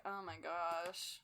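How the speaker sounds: quiet, slow, unimpressed; soft slow mock sigh. Reacting to Ned's chapter, quiet slow unimpressed. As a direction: let it fall out softly, bored. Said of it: "I'm just kind of like".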